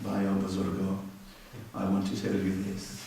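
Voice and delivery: soft, low, subdued sermon voice; very calm Indian preacher. Retold or imitated. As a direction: imitate a gentle preacher addressing his elders quietly. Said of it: "very calm".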